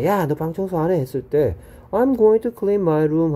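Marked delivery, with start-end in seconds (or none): 0.0-1.0 mock angry mom. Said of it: "엄마가". 1.9-3.3 higher, slow reply to an angry mom; a light hint of a kid answering mom; demo reply to mom. Shown as a demonstration. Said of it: "뭐라고 대답하는 느낌이냐".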